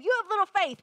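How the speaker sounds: high, loud mock reproach; harsh rebuke. Quoting the response to be corrected, harsh rebuke. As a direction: throw out the accusation forcefully. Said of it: "So instead of".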